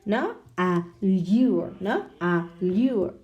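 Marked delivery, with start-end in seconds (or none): 0.6-1.7 "Allure" with the weight on "lure"; drawn-out second syllable in "Allure"; last-syllable stress. Shown as a demonstration. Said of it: "the stress is on the last syllable". 2.2-3.1 "Allure" split, leaning on "lure"; teacherly "Allure", second syllable held; last-syllable stress. Shown as a demonstration. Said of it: "the stress is on the last syllable".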